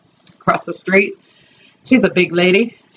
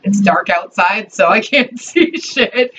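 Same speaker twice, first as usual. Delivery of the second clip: ordinary talk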